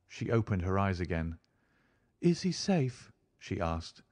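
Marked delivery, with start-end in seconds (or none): 2.2-3.1 high-pitched, worried woman; anxious woman's voice. A narrator's reading. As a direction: ask anxiously in a high female voice. Narrator voices anxious Mrs Stapleton.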